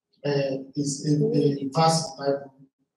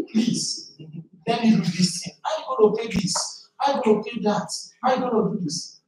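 mock furious wife; 7 semitones higher than usual. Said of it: "This woman was so furious with me".

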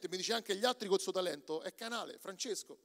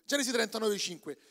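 normal talking voice

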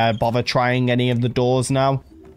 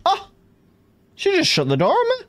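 indignant surprise. Real, not acted. Door shut in face.

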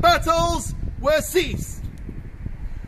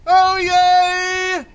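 cheering; 3 semitones higher, 7 dB louder, much slower than usual. Real, not acted. Cheering celebration opening.

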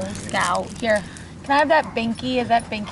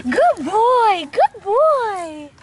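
squeaky praising a dog; 9 semitones higher, 8 dB louder, much slower than usual. Real, not acted.

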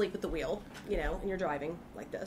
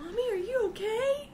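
put-on high, puzzled little kid; kids' voice; 13 semitones higher than usual. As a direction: play a bewildered child hearing mom's accent. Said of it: "my kids will look at me like".

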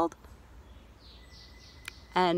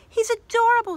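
high, louder gushing mother; doting Mama; 13 semitones higher, 5 dB louder than usual. Acted. Mama gushing over the new baby, high and loud. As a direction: coo over the baby like a smitten mom.

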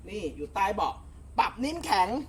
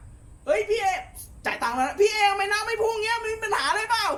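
much higher, complaining customer voice; imitating a customer; 11 semitones higher than usual. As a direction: whine like a paying customer whose boat won't spray. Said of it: "หลายคนถามว่า". (เลียนเสียงลูกค้าบ่น เสียงสูงมาก)

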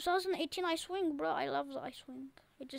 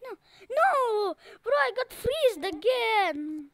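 much higher and louder frantic yelling; panicked shout; 7 semitones higher, 8 dB louder than usual. Shown as a demonstration. Gamer shows how to freak out at the freezer, yelling in panic. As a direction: shriek like the freezer just caught you. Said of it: "Say it like this".

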